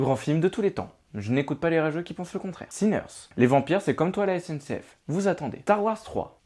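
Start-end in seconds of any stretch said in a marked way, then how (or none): none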